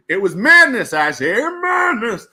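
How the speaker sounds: loud, high theatrical exclamation; mock-dramatic outcry. Streamer hamming up his own story, mock-dramatic outcry. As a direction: cry it out like a ham actor.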